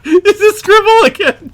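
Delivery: very high, loud squealed words; helpless laughter. Real, not acted. Teasing co-host who notes loud laughs, helpless laughter. Laughing at co-host's note-taking.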